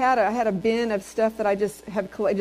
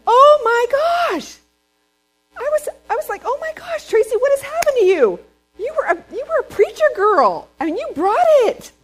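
much higher and louder, squealing with astonishment; amazed exclamation; 8 dB louder than usual. Retold or imitated. Tracy hearing her old sermon tape, astonished and much higher. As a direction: gasp at your younger self in disbelief.

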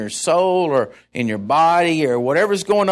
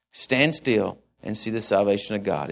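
quiet voice of God; 8 semitones lower, 5 dB quieter than usual. Acted. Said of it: "God is saying to us".

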